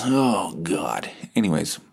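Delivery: normal talking voice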